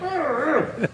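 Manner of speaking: high frantic arr noises; mock panic arr sounds. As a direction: grunt like a flustered pirate. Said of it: "I'm like".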